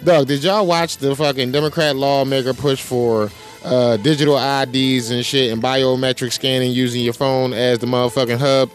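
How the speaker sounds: ordinary delivery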